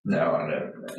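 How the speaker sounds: ordinary talk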